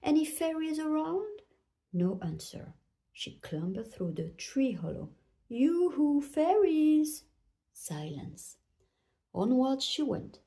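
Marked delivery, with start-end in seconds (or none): 0.0-1.4 high, rising searching call; sing-out question, pitched up. A narrator's reading. Calling into the empty grove. 5.5-7.2 high, louder yoo-hoo call; sing-song searching call. A narrator's reading. Calling for the hidden fairies.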